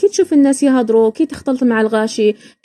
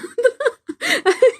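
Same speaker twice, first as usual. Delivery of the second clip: laughing; 13 semitones higher than usual. Real, not acted. Laughing at own story.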